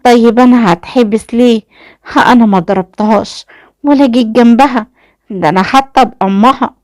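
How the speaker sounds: fearful. A narrator's reading. Mother pleading she never hit her daughter, fearful. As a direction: protest in fear, pitch lifted. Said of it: "اتكلمت وقتها والدة ليلى وقالت بخوف".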